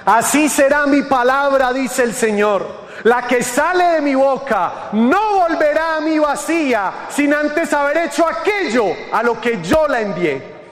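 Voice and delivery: high, ringing preacher's voice; scripture proclamation. Acted. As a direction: proclaim the verse with rising fervor. Scripture proclaimed, later repeated low.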